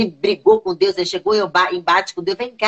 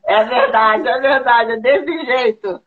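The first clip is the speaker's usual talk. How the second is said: laughing through the words, slowing at the end; amused laughing yes; laughing agreement; 3 semitones higher, slower than usual. Real, not acted.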